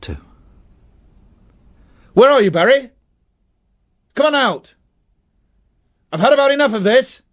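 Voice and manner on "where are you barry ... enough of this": high, loud, angry yelling across the woods; angry calling out. Acted. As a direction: bellow for the boy to come out, fed up. Man calling for hiding boy.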